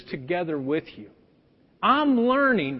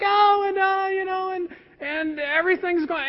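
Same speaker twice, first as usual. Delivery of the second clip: much higher, breezy imitation of wife's small talk; mock chatter; 9 semitones higher than usual. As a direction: parody her easy social chatter, pitched way up. Mimicking his wife's chatter.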